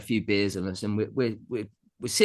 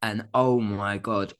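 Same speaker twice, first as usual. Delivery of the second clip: ordinary talk, nothing marked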